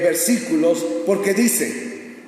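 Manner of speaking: ordinary talk, nothing marked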